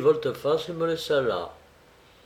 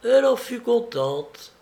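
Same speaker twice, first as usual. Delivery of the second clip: higher-pitched proud report; 9 semitones higher, 4 dB louder than usual. A narrator's reading. Felipe reports his sister's approval, proudly. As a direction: lift the voice with pride. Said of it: "ajouta-t-il fièrement".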